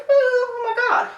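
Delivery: squeaky voice of a shocked dog; alarmed dog voice. Pretend. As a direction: voice the startled dog. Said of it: "and they go".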